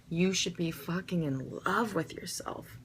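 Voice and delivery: breathy urgent whisper; hushed, fervent confession. Real, not acted. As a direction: lean in and whisper it like a secret. Intimate emphatic aside to viewers.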